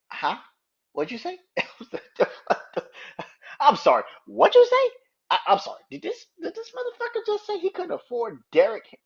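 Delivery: high-pitched, laughing disbelief with sudden loud bursts; incredulous double take. Acted. Fan reacting to a billionaire pleading poverty, incredulous double take. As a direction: squeal it as if you must have misheard. Acts out hearing the absurd excuse.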